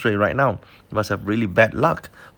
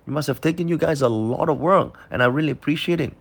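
curious; 8 semitones higher than usual. Retold or imitated. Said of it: "I'm just a bit curious".

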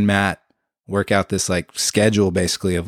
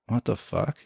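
puzzled mutter; 8 dB quieter than usual. Retold or imitated. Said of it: "And I was like".

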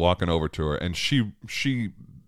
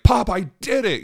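high, loud imitation of a kid's yell; retold scream; 6 dB louder than usual. Son screaming to dad from far off, triumphant imitation. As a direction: yell it across a distance like an excited child.

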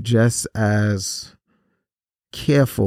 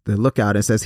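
normal talking voice

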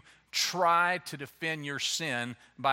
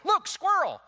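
sudden high, loud cry of look squirrel; mock distraction; 14 semitones higher, 6 dB louder than usual. Preacher mimicking someone dodging blame, high excited cry. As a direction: blurt it out to change the subject.

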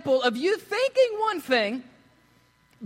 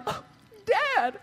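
mock weeping; 8 semitones higher than usual. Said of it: "His son is weeping like".

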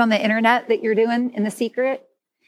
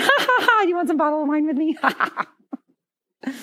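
laughing; 5 semitones higher than usual. Real, not acted. Laughing over a wine joke.

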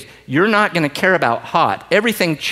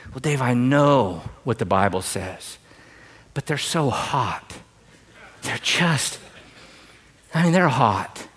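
swooning congregant; 5 semitones lower, 5 dB quieter than usual. Retold or imitated. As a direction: sigh it like a smitten young believer. Voicing a smitten congregant.

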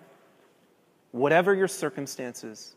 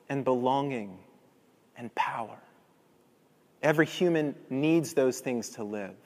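hushed sermon close; 2 semitones lower, 3 dB quieter than usual. Acted. Soft reflective sermon close.

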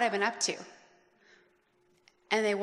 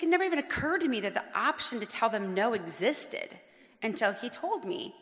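normal talking voice

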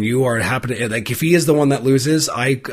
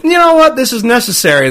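high mock voice; 10 semitones higher, 4 dB louder than usual. Mock inner voice, self-quote.

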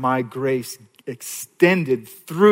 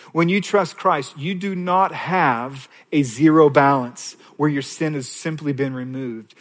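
normal talking voice

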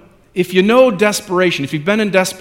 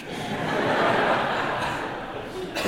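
breathy laugh; 9 dB quieter than usual. Real, not acted. Preacher laughs at himself.

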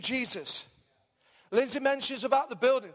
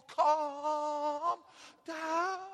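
soft, long-stretched "calm down"; put-on hushing, softly drawn out; mock placating; 4 semitones higher, 3 dB quieter than usual. Killjoy dampening excitement over community activity, mock-soothing. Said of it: "You know, people just".

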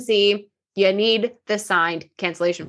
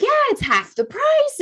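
put-on much higher, proud kid's voice; mimicking stepson. Stepmom imitating stepson bragging about cheap insurance, high pitched. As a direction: play the naive boastful young man.

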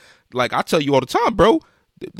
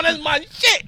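mock hype kid; slower than usual. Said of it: "He was like".

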